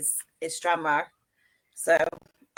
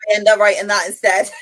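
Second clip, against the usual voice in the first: laughing; 8 dB louder than usual. Real, not acted. Laughing confession to host.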